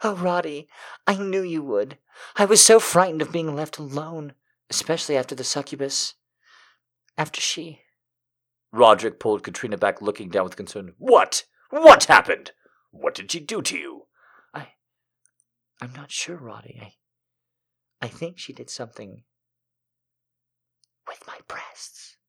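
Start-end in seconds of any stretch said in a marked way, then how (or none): 0.0-7.8 high, whispery heroine voice; frightened damsel. A narrator's reading. 11.0-14.1 high, urgent questioning; alarmed hero. A narrator's reading. Hero reacts with concern. 14.5-22.2 very soft, halting confession; hesitant damsel. A narrator's reading.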